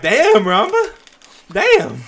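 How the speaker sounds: high, loud mock amazement; impressed exclamation. Co-host reacting to Ramba's bare-hands boast, exaggerated amazement.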